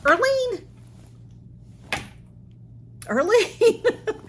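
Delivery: high, loud, laughing calls of a name; calling out a friend's name again and again, overwhelmed; overjoyed cries. Real, not acted. Finding the bag filled with gifts, crying her friend's name with laughs.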